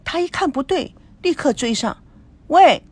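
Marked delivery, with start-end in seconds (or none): 2.5-2.8 loud high 喂 after them; calling out. Acted. 高培志追上去大声喊住众人. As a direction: shout to stop them. Gao chases after the group.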